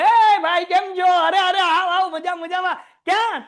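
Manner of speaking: loud, high, hearty street greeting; eager cousin greeting. Acted. Cousin greeting the student on the way home, loud and hearty. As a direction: call out like a delighted cousin. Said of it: "મામાનો છોકરો મળ્યો. કીધું".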